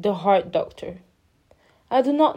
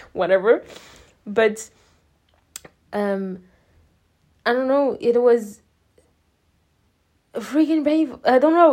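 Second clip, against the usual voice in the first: ordinary talk, nothing marked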